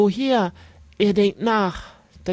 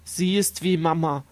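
usual speaking voice

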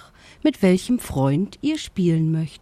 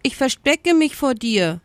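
high-pitched brusque retort; 8 semitones higher than usual. A narrator's reading. Hiding ostrich answers the lion, brusque. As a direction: answer curtly, wanting to be left alone. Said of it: "antwortete der Strauß schroff".

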